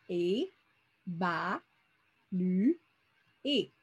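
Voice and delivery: "Et, ba, lu, et" spoken one syllable at a time with pauses; syllables split apart. Shown as a demonstration. Teacher splitting the word for pupils, syllable by syllable. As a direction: tap out each syllable of évaluer separately. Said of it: "c'est le nombre de fois qu'on ouvre la bouche".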